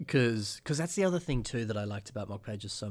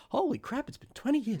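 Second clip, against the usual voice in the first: shocked surprise. Real, not acted. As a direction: pitch jumps way up in real alarm. Realizing how long it has been.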